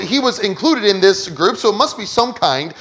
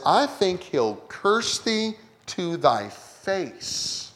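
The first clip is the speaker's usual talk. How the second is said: a slow, hushed, sly Satan voice, much softer; 8 dB quieter, much slower than usual. Acted. Preacher voicing Satan's taunt to God, hushed and sly. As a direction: lean in and sneer it quietly, very slowly.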